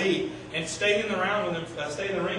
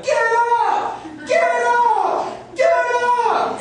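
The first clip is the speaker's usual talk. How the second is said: much higher and louder, yelled get up; trainer yelling; 9 dB louder, much slower than usual. Retold or imitated. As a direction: shout at the fallen boxer to rise. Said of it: "my trainer was over there yelling".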